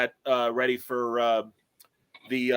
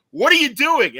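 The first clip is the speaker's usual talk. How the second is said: higher and louder, an admiral demanding answers; admiral's outburst; 11 semitones higher, 8 dB louder than usual. Retold or imitated. Admiral confronting Scotty over the glitch, mock outrage. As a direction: bark it like a startled admiral. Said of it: "his admiral's like".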